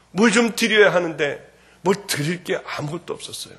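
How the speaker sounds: ordinary talk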